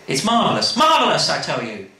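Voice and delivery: hammed-up rapture over a record; mock rapture. Lee raving about the record.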